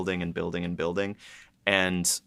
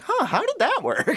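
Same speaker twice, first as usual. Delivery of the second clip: much higher, baffled question; puzzled; 3 dB louder than usual. Retold or imitated. Writers puzzled by their own lore, high baffled. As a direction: sound genuinely stumped. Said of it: "we're like".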